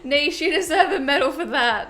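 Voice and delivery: laughing. Real, not acted. Streamer laughs at a perfectly timed donation, laughing. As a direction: crack up as you say it.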